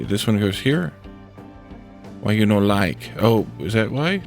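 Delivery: normal talking voice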